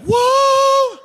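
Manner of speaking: long high loud whoa; a sustained, high-pitched whoop of amazement; amazed onlooker. Acted. Onlooker reacting to a super-fast drinker, amazed high whoop. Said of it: "and you're like".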